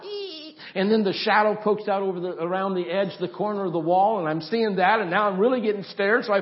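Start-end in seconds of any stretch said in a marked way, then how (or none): 0.0-0.6 high thin imitated door creak; door squeak. Preacher imitating his bedroom door creaking open. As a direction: squeak like a slowly opening door. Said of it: "I hear the door open a little bit more".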